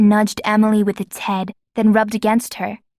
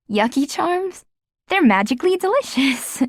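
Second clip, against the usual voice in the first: higher, teasing cereal-ad lilt; playful exclaim; 6 semitones higher than usual. A narrator's reading. Emily teasing Adrian about the charms, playfully exclaimed. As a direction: toss the phrase back with a grin. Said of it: "Emily exclaimed".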